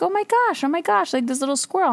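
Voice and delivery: high-pitched adoring gush; gushing squee. Retold or imitated. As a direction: squeal with delight at the little squirrel. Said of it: "I'm like".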